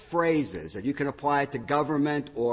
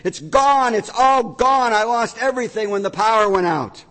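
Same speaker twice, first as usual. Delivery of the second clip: high, loud panicked cry; high frantic scream; 8 semitones higher, 6 dB louder than usual. Acted. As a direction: wail it like you've lost everything.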